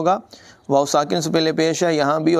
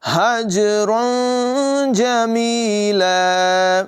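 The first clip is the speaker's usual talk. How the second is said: slow, higher melodic tajweed chant; Quran recitation; 6 semitones higher, much slower than usual. Shown as a demonstration. Teacher recites the verse for students, slow melodic chant. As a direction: chant each word long and flowing.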